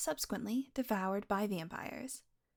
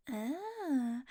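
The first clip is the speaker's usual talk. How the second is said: normal talking voice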